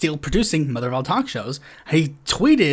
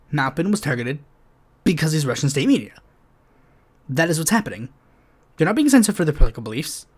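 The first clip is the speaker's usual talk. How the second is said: ordinary talk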